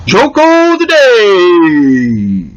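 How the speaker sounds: loud, slow announcer call; loud drawn-out title announcement; segment announcer. Acted. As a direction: boom out the segment title like a show host.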